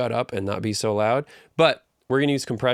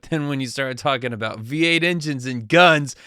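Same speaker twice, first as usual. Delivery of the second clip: higher and louder, getting fired up; excited; 5 semitones higher, 5 dB louder than usual. Shown as a demonstration. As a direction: get worked up over V8 engines. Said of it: "I get really excited".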